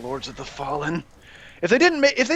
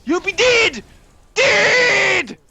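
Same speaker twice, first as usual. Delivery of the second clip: doom-laden teacher rant, building from high to a shouted "Doomed!"; Invader Zim teacher; 8 semitones higher, slower than usual. Retold or imitated. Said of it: "To quote Invader Zim".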